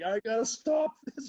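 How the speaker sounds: high, loud, drawn-out mock cry; exaggerated high-pitched alarm; retold panicked cry. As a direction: call out as if desperate to halt it. Retold frantic attempt to stop chaos.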